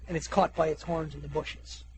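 ordinary delivery

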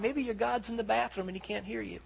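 lightly acted taunting. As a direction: taunt them with feigned concern. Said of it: "he begins to taunt them".

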